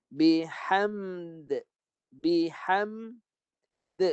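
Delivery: Bihamd with the final d held then bounced; slow, careful model reading of Bihamd, the d released with a light echo; model recitation. Shown as a demonstration. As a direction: read Bihamd so the last d stops then rebounds. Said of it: "Dengar saya baca".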